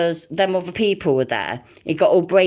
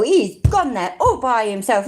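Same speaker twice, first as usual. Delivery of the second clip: ordinary talk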